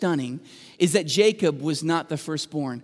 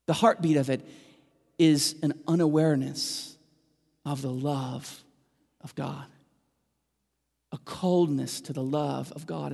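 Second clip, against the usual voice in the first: usual speaking voice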